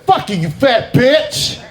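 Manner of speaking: high punchy insult, drawn out; mock yell at student. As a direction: fling the insult up high and slow.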